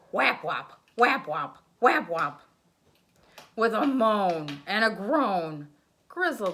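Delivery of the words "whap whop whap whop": usual speaking voice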